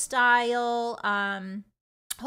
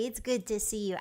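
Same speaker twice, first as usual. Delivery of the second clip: ordinary delivery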